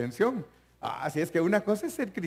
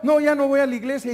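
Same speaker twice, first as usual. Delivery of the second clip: mock excuse; 9 semitones higher, 7 dB louder, faster than usual. Acted excuse of an ex-member.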